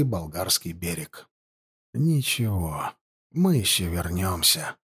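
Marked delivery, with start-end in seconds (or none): 1.9-4.8 low, hushed murmur; near-whisper. A narrator's reading. Jaeger officer looking back at the lost shore, whispering. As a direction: say it softly and low, to yourself. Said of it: "прошептал егерский капитан-поручик".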